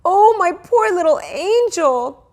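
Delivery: high, slow, pitying croon; pitying mother. A narrator's reading. Mother pities her toddler, high and slow. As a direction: mourn over the baby. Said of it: "my mother cried".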